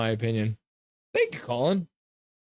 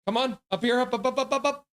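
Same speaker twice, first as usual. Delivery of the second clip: coaxing dog talk; 8 semitones higher than usual. Real, not acted. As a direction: coax the dog up onto your lap. Calling dog up again.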